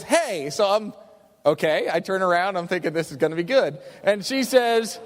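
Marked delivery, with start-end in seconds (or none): none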